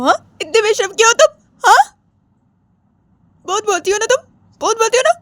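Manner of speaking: building to a high, crying rant; wounded fury, increasingly high-pitched; tearful scolding. Acted. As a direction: scold through tears, pitch climbing steadily. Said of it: "Ritu वैसे रोते रोते जिया को call लगा".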